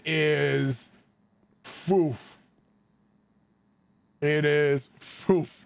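slow, deliberate emphasis; slow drawn-out. Real, not acted. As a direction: draw each word out. Reacting to her face.

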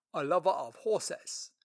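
slow, loud, stately official; Lord Treasurer voice. Acted. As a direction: speak like a dignified royal treasurer.

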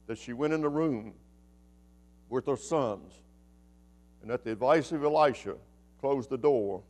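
soft, low, reverent story narration; hushed storytelling. A narrator's reading.

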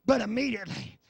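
high, loud, drawn-out sermon cries; full-force preaching. Real, not acted. Preacher telling how Jesus caught sinking Peter, full-force preaching. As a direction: shout it in slow, punched phrases.

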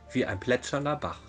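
ordinary delivery

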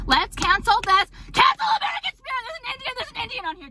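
high, fast parody of an outraged activist; mocking woke voice. Mocking woke people as she pretends to cancel cigarettes, high and fast. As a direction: squeal it like a scandalized activist.

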